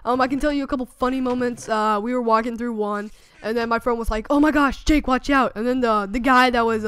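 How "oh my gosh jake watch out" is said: retold friend's warning, pitch lifted; mock-urgent heads-up, higher and louder; alarmed warning. Friend warns him while walking through a haunted house, alarmed.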